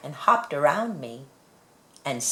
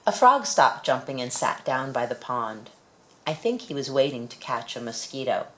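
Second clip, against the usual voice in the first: usual speaking voice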